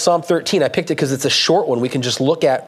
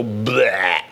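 a dismissive low blurt; mock vent noise; mock venting bleh; 6 semitones lower than usual. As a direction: spit out a throwaway bleh at God.

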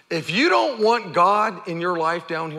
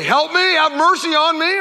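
louder, higher desperate plea; crying out for mercy; 9 semitones higher, 5 dB louder than usual. Retold or imitated. A leper crying out to Jesus, loud desperate plea. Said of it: "They could scream out and".

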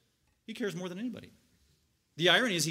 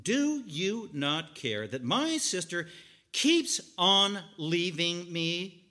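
drawn-out accusation; 2 semitones higher, 6 dB louder, slower than usual. Retold or imitated. Martha accusing Jesus, slow and building to loud. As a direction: stretch out the grievance, then explode. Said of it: "this is not far from it".

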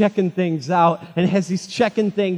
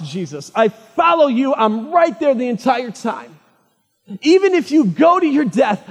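Peter's vow, raised high and loud; eager vow; 6 semitones higher, 6 dB louder than usual. Retold or imitated. Peter swearing to Jesus he'll die with him, high and loud. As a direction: swear loyalty with fervent energy.

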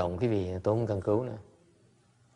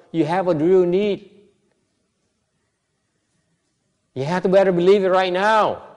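louder, higher, driving the point home to the hall; full-force preaching; 6 semitones higher, 9 dB louder than usual. Real, not acted. The master insists to the congregation that their need is real, preaching at full force. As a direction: preach it hard and loud, pressing every phrase.

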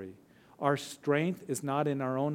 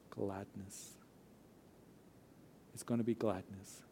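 soft emphatic answer; 4 semitones lower, 10 dB quieter than usual. Real, not acted. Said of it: "answers own question softly".

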